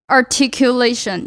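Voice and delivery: ARTICULATION sounded out syllable by syllable, slowly and distinctly. Shown as a demonstration. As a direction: give each syllable of "articulation" its own careful beat. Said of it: "一个字，一个字，一个字地发". (一个音节一个音节清楚地念出articulation)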